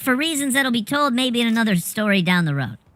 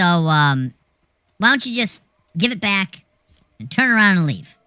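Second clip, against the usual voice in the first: halting, lightly imitated low-key guy; Melvin; 5 semitones lower than usual. Melvin asking Joey to return his stuff, lightly imitated halting voice. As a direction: stammer through an awkward request, voice dropping. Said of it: "I'll do my best Melvin impression".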